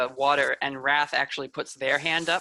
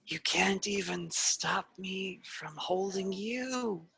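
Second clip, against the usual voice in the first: slow, higher, softer sneer; villain gloating softly over her trapped sister; gloating spirit; 5 semitones higher, 7 dB quieter, slower than usual. Acted. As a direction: let the cruelty linger, slowly. Wrath gloating over trapped Aiden.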